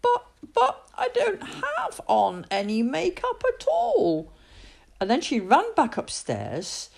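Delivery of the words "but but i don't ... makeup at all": put-on high, stammering girl voice; confused Julie. Julie protests to her family that she wears no makeup, high and stammering. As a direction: protest in disbelief, slowly. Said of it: "And Julie said".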